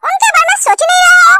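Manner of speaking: exasperated shout at the family; indignant outburst. Acted. Complaining family ignores his marriage.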